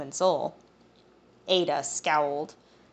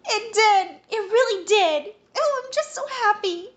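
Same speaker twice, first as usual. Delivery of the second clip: much higher, squealing with joy; young woman squealing happily, far higher and louder; excited; 6 dB louder than usual. Acted. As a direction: bounce with giddy excitement. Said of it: "giggled and jumped with excitement".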